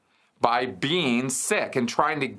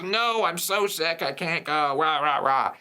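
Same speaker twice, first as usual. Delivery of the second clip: put-on whining kid; 5 semitones higher than usual. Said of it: "he'll keep just".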